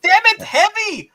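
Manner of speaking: high, loud cry of exasperation; exasperated shout. Real, not acted. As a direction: yell it in exasperated protest. Mock outburst at co-host over purchase talk.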